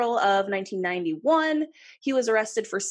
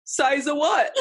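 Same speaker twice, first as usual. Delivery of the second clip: laughing; 7 semitones higher, 4 dB louder than usual. Acted. Repeating the joke laughing.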